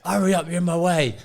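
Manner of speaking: high, loud jeering yell; retold football fan shouting, half laughing; mock fan heckle. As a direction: bellow the insult from the terraces. Fan heckles physio from stands.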